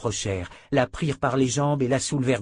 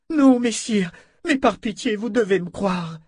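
very high, pleading cry; supplication désespérée; 10 semitones higher, 4 dB louder than usual. A narrator's reading. Captive begs to be believed. (imploration aiguë et affolée)

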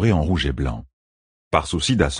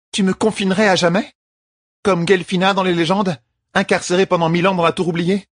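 indignant queen; 13 semitones higher than usual. A narrator's reading. Queen protests to her champion, indignant. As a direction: demand it in disbelief.

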